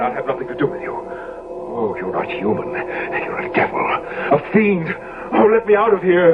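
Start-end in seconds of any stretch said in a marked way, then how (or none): 1.0-3.8 hushed horror. Acted. Basil recoils from Dorian. 3.8-6.3 high, rising panic; desperate to get out, voice climbing; panicked flight. Acted. Basil panics and tries to flee.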